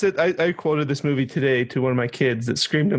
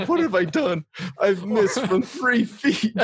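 mock dismay; 6 semitones higher, slower than usual. Quoting the hero's dismay.

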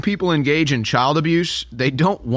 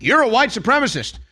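mock accusation; 9 semitones higher than usual. Mocking accuser's stock line.

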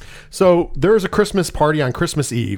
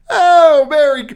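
much higher and louder, a sudden yelp; startled exclamation; 11 semitones higher, 7 dB louder, slower than usual. Retold or imitated. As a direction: jump at the words like you're caught off guard. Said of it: "He's like".

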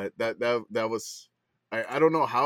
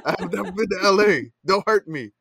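mock pleading; 5 semitones higher, 5 dB louder than usual. Pleading with radio host.

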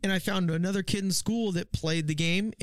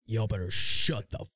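roommate's stern order; 7 semitones lower than usual. Retold or imitated. Roommate coming down to silence noisy friends, stern.

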